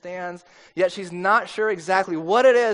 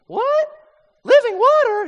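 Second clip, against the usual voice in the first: mock dim-witted squeal, much higher and louder; clueless woman; 10 dB louder than usual. Preacher mocking how others play the Samaritan woman, high and dim-witted. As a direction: ham up a clueless woman baffled by the idea. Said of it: "they always make the woman sound like, like an idiot".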